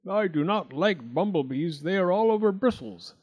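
lightly voiced fussy toad. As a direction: grumble about the bristles. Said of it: "said Mister Jackson".

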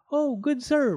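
high, loud piping pig voice; high-pitched piglet hailing a stranger; piping little pig. A narrator's reading. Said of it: "piped the pig".

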